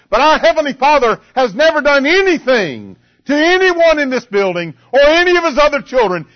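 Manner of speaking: high, forceful pulpit delivery; impassioned preaching. Acted. Preacher to congregation on the Father's love, impassioned. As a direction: build it up and punch the key words.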